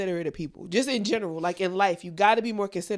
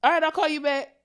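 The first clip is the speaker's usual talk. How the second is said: brushing someone off; 6 semitones higher, 5 dB louder than usual. Retold or imitated. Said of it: "And I've said it".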